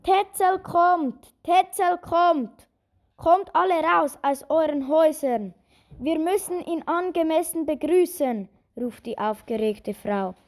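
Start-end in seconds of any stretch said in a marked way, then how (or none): 0.0-8.5 high, loud excited shout; calling out loudly. Acted. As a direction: call it out across the market square. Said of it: "Sie beginnt laut zu rufen".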